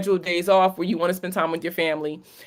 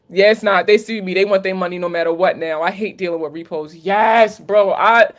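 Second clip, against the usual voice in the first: usual speaking voice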